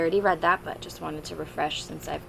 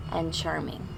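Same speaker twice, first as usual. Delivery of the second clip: usual speaking voice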